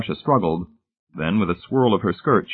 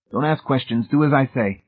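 higher-pitched, firm order; instructing; 5 semitones higher than usual. A narrator's reading. Rescuer directing the boy to help, instructing firmly. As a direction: command obedience without raising volume. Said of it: "he instructed the boy".

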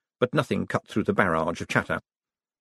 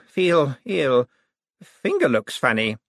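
sick mumble; 4 semitones higher, slower than usual. A narrator's reading. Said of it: "before reverting to".

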